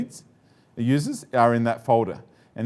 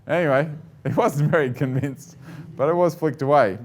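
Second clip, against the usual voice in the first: usual speaking voice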